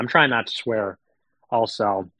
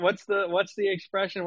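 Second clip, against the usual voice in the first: ordinary talk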